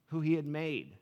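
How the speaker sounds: ordinary talk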